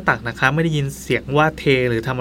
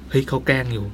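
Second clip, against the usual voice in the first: self-reassuring mutter; 2 semitones lower, 7 dB quieter than usual. Retold or imitated. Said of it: "หลอกตัวเองว่า".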